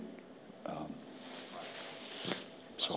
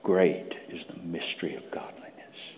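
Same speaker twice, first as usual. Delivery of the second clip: reverent whispered refrain; hushed scripture; 3 semitones lower, 9 dB quieter than usual. Acted. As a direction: whisper the verse in awe.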